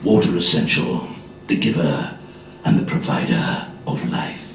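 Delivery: deep Richard Burton advert read. Retold or imitated. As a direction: intone it deep and solemn like Burton. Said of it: "my best Richard Burton impersonation".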